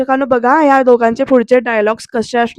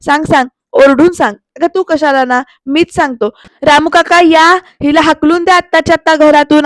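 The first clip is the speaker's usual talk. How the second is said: loud, higher call to the servant; 3 semitones higher, 5 dB louder than usual. Retold or imitated. Pruthvi shouting for Ramu kaka to throw Aarti out.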